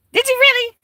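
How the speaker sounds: high, loud delighted disbelief; a squeal of surprise at the chat; surprised. Real, not acted. To a viewer, a high, loud surprised squeal.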